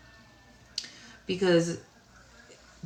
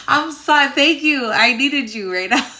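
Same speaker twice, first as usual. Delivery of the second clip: higher, louder, gushing thanks through laughter; excited laughing thanks; 7 semitones higher, 10 dB louder than usual. Real, not acted. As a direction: burst out thanking her, still laughing.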